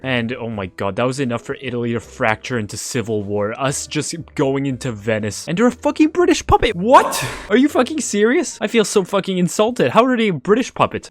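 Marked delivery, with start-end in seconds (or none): 5.5-11.1 high, loud outraged yelling; furious outburst. Real, not acted. Yelling at the screen over a British puppet, outraged. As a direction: explode in disbelief at the game.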